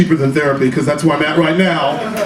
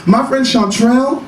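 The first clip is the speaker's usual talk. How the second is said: niece voice; 6 semitones higher than usual. Retold or imitated. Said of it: "She's like".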